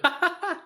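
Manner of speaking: high, loud burst of laughter; shrieky staccato laugh; big laugh. Real, not acted. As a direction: crack up loudly at your own joke. Laughing at height guesses.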